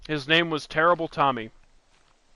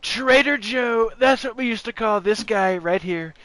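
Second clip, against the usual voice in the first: hammed-up Donald Trump; Trump impression; 7 semitones higher than usual. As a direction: do Trump stretching out the words. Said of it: "Trump's just like".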